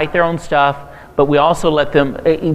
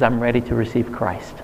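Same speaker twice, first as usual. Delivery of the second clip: softer, lower, subdued reported confession; retold tearful surrender; 4 semitones lower, 6 dB quieter than usual. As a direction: quietly imitate a man moved to tears. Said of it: "he just started crying".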